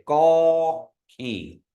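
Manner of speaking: gaw keen slow, gaw stretched long. Shown as a demonstration. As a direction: hold the gaw vowel long. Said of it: "making it longer".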